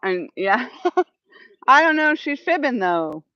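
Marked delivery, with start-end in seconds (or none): 0.8-2.8 laughing. Real, not acted. Laughing about chat's snake claim.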